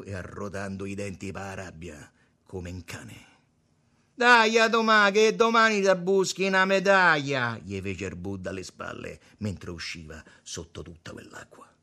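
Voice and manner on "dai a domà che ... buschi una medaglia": high, loud jeering call; mocking shout after someone. A narrator's reading. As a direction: shout it at his back as he leaves. Il Buddha gli grida alle spalle. (grido di scherno alle spalle)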